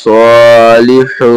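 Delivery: সলিহু slowly stretched, the স held long; slow teaching recitation; drawn-out recitation. Shown as a demonstration. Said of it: "সদ খারা যাবার সদ".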